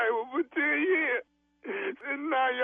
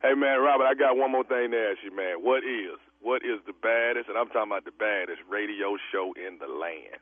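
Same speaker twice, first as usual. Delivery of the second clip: low, booming radio promo voice; radio announcer. Acted. Radio host hyping his own show to the pranked caller, booming announcer voice. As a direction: boom it out like a station ident.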